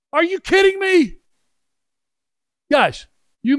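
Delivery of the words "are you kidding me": high, loud disbelief; can you believe it, stretched and soaring; astonished. Real, not acted. Preacher marvelling at sitting beside the Pokot, astonished and loud. Amazed at divine coincidence.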